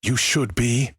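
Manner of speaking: high-pitched, narrated menace; hissed threat. A narrator's reading. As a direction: warn her with pitch lifted, mildly menacing. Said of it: "Walter hissed".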